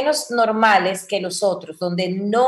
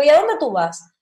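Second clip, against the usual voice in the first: higher, serious demand with a falling end; no-smile, pointed question; serious, unsmiling; 5 semitones higher than usual. Shown as a demonstration. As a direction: ask it seriously, without a smile. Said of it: "si yo la digo seria".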